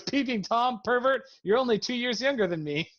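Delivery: high-pitched mock outrage; acted indignant accusation; outraged scolding.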